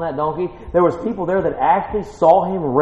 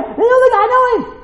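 very high, giddy acted cry; hammed-up excitement; excited crowd; 6 dB louder, faster than usual. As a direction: claim him like a thrilled onlooker. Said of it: "Be excited".

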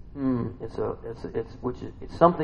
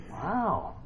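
ordinary talk

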